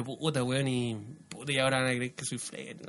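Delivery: very soft, low muttering; worried inner voice. Acted. Man dreading his friend's judgement, low soft mumble. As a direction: fret under the breath. Acted anxious thoughts awaiting friend's reaction.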